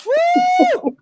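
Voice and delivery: high whoop of joy; excited whoop. Real, not acted. Host hyping her audience about Wendy news, high whoop. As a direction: let out a loud celebratory woo.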